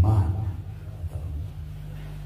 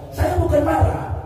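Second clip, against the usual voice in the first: 1 denying anger; 10 semitones higher than usual. Retold or imitated. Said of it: "Padahal dia udah, udah, udah meledak".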